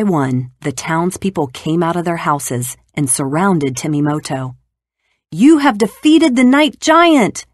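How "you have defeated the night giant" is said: high, loud, slow cheer; cheering crowd. Acted. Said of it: "they cheered".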